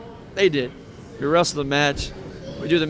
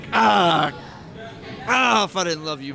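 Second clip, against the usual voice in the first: imitating a friend's exasperated cry, pitched up; mock frustrated outburst; 4 semitones higher than usual. Friend stopping short of punching his best friend, mock frustrated. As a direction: burst out, holding back a pretend punch.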